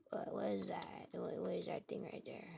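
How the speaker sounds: hushed, breathy whisper in the dark; scared whisper. Acted. Chica creeping through the dark room, whispering. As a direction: whisper it like you can't see a thing.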